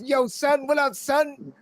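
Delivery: high-pitched imitation of New York slang; mock New York talk. Strangers mimicking how New Yorkers talk, high mock accent. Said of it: "all y'all talk like that".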